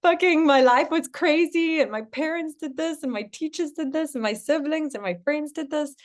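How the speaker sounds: overwhelmed rant. Pretend. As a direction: vent it all like it's too much. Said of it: "you're feeling a little bit overwhelmed and you're like".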